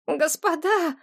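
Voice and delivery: high, pitiful appeal; plaintive boy. A narrator's reading. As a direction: babble it in a small begging voice. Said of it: "залепетал он прежним жалобным голосом". (жалобный тонкий голос)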